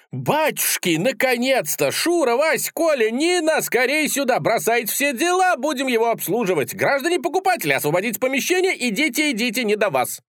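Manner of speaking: very high, frantic shop assistant; flustered calling out. A narrator's reading. Seller summons staff to serve him, flustered calling out. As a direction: call everyone over excitedly, racing by the end.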